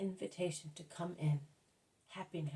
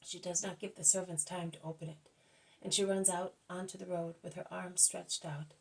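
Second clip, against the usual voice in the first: ordinary delivery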